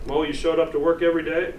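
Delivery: ordinary talk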